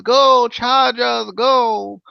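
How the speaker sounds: put-on slow, sung Jamaican-accent chant; mock Jamaican accent. Host cheering the Chargers in a mock Jamaican accent. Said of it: "Why Jamaican?".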